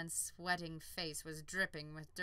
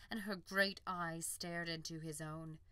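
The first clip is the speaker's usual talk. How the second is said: ordinary delivery, nothing marked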